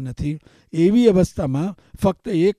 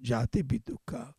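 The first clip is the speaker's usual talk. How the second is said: whisper; 3 semitones lower, 12 dB quieter than usual. Shown as a demonstration. Whispered repeat.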